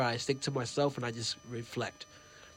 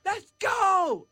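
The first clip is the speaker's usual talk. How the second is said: much higher, louder, slow drawn-out shout; excited yell; 5 dB louder, much slower than usual. Retold or imitated. Fan shouting after meeting his hero, high excited yell. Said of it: "I yelled".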